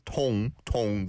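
"Tong, tong" with a clear "or" vowel; vowel demonstration. Shown as a demonstration. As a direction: say "Tong, tong" twice, modelling the "or" vowel. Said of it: "the or vowel sound".

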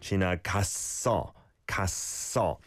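갔어 with a long, tense ss; double siot. Shown as a demonstration. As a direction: close the first syllable of 갔어 on s, then carry it over. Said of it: "The double shiot sound is on that first syllable".

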